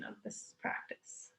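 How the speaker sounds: fades to a breathy whisper at sentence end; soft trailing whisper. Real, not acted. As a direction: let the words of this practice drop into a whisper.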